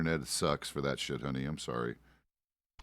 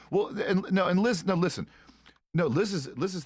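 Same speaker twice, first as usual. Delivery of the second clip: higher, louder cutting in over the others; eager interruption; 11 semitones higher, 5 dB louder than usual. Real, not acted. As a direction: jump in loudly to defend her. Cutting in to defend Liz.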